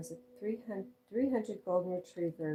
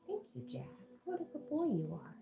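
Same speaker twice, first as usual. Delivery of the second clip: high pet-talk praise, softer; praising the dog; 7 semitones higher, 4 dB quieter than usual. Real, not acted. Owner praising her dog Jack in high pet talk.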